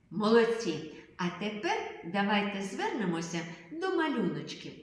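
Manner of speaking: normal talking voice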